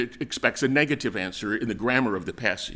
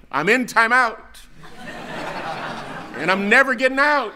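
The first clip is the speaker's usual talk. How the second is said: put-on child's yell; 9 semitones higher than usual. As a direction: yell it like a doomed kid calling from the bedroom. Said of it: "and he's yelling".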